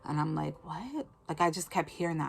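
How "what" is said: soft, high questioning squeak; bewildered. Retold or imitated. Puzzled by the Lord's instruction, bewildered and high. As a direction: recall a puzzled, hushed reaction.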